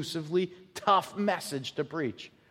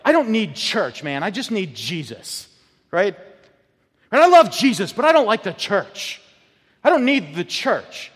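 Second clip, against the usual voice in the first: high, loud mock of a churchless believer; dismissive young believer; 6 semitones higher, 6 dB louder than usual. Preacher mimicking a generation that rejects church, with dismissive attitude. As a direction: toss off the church like a bored kid. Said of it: "this whole idea where, like".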